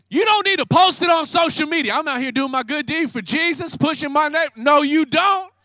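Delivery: loud, high, rising preacher's cry; shouted rebuke from the pulpit; preaching shout. Real, not acted. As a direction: drive it home at full force. Preaching at full force.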